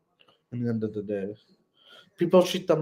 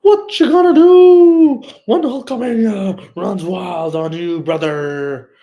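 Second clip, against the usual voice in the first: much higher, louder, slow drawn-out wrestler promo voice; wrestling promo catchphrase; 8 semitones higher, 9 dB louder, slower than usual. Retold or imitated. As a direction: ham it up like a wrestler cutting a promo. Wrestling catchphrase repeated, then laughs.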